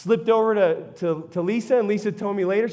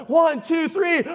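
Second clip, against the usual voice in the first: counting shout; 5 semitones higher, slower than usual. Retold or imitated. Count to push pallet jack.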